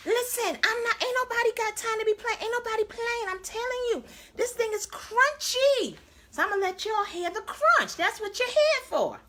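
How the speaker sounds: high hyped-up bragging; excited. Real, not acted. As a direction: pitch way up, hype the crunch. Hyped before biting chicken.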